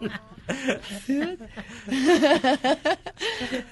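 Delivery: real, drawn-out laughing fit; long laugh. Real, not acted. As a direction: laugh it out at length. Teased into demonstrating the accent, laughs.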